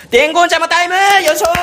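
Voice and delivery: loud high game-show call; announcer shout. Acted. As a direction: shout the segment title.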